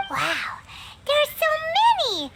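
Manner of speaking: amazed. Acted. Paimon flipping through the pile of directives, amazed. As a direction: marvel at the length of the list. Reading 187 outcomes list.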